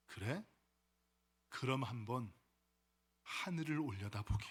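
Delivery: low, soft elder voice with pauses; old man. Acted. Old man to the lamenting youth, hushed elder voice. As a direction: quietly advise as an unhurried old sage. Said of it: "한참을 듣던 노인이 이렇게 이야기합니다".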